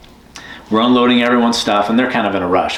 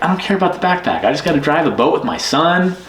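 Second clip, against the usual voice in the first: imitated Bill; 6 semitones higher than usual. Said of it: "It's like".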